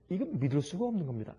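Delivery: normal talking voice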